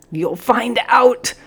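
slow, high, straining voice; strained voice. A narrator's reading. Defiant cadet answering the sergeant mid-pose, strained voice. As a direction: force it out slowly under strain. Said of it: "came a strained voice".

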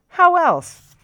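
high, loud triumphant question; obvious, delighted; excited exclamation. A narrator's reading. Captain exclaims.

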